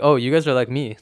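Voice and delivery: usual speaking voice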